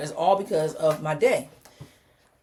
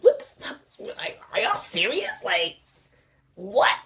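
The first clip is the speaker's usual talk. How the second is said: stunned protest, drawn out; retold disbelief; 2 semitones higher, slower than usual. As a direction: act out being flabbergasted. Retells disbelief at doctors' news.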